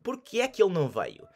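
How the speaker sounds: quick, words run together; native speed. Shown as a demonstration. Teacher repeating the native-speed version, quick and connected. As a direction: rush it like a local. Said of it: "as genuine and authentic".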